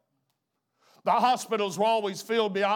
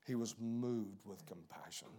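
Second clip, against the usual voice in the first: tender hushed restatement; hushed repeat; 6 semitones lower, 12 dB quieter than usual. Acted. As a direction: drop to a near-hush on He. Repeats the verse after a pause.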